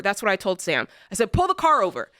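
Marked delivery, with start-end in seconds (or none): 1.3-2.1 higher, insistent command; retold furious order; angry demand repeated. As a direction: bark it at the driver again. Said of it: "I was so angry".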